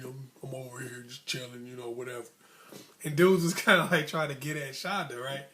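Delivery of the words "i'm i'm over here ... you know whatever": softer, a hint of a cool-guy deep voice; mock deep voice. Boy acting cool for Shonda, soft mock deep voice. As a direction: lightly play the kid acting cool. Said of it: "Dude was trying to do the deep voice".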